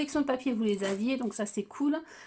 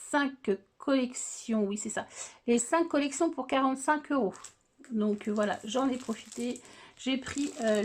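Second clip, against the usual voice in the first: usual speaking voice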